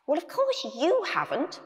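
high, fussy little character; first, worried voice. A narrator's reading. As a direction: retort in a small high voice. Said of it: "said the first voice".

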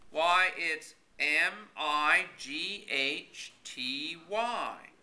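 letters spelled out slowly; slow spelling. A narrator's reading. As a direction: spell each letter deliberately. Spelling a word out.

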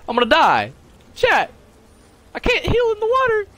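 high shrieking panic; panicking gamer. Real, not acted. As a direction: scream it, about to die. Dying in game, screams.

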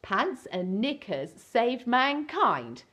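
ordinary delivery, nothing marked

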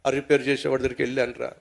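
low, soft, sheepish answer; small, subdued voice; meek reply. Acted. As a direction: answer timidly under a telling-off. Culprit answers meekly.

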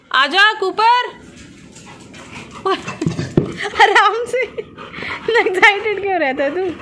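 high, loud call to the dog; excited calling. Real, not acted. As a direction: coax the dog loudly and brightly. (कुत्ते को ऊँची, ज़ोरदार पुकार)

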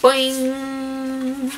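drawn-out springy boing; bouncy boing. Acted. Animals jumping in the board book, springy boing. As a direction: bounce the word. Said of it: "they always go".

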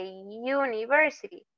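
"university" with a drawn-out you at the start; y-sound U. Shown as a demonstration. Teacher showing the sound of U, "university" begun with a long you. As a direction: stretch the opening y glide of "university". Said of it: "Tiene el sonido como de U".

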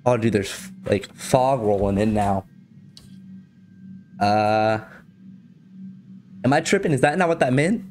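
normal talking voice

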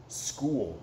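ordinary delivery, nothing marked

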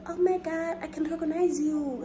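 high, excited gushing; squealing fan recognizing her. Retold or imitated. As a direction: gush like a starstruck fan spotting you. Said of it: "and that girl was like".

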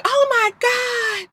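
high, drawn-out stunned gasp of words; mock astonishment. [name] reacts to the pappy news, mock astonishment. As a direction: stretch it slowly in a high, softly scandalized voice.

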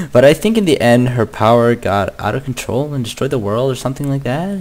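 ordinary talk

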